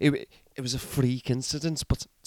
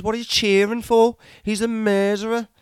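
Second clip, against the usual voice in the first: angry outburst; 7 semitones higher, 3 dB louder than usual. Retold or imitated. Shouting at cheering friends.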